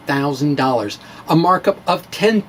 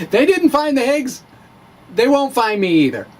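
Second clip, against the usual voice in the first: higher, laughing through the words; 7 semitones higher than usual. Real, not acted. Joking he'll hide in the LHC, laughing. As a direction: chuckle at your own joke.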